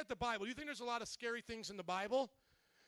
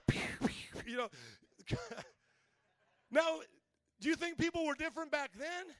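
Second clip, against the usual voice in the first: normal talking voice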